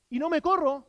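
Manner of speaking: usual speaking voice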